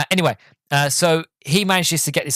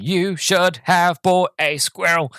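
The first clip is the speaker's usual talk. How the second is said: slow, word by word, slightly higher; sign read-out; 4 semitones higher, slower than usual. Retold or imitated. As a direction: spell out each word like successive signs. Reads out the mocking roadside signs.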